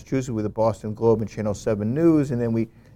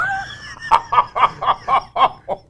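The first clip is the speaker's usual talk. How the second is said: burst of laughter; 13 semitones higher than usual. Real, not acted. Laughing at own punchline.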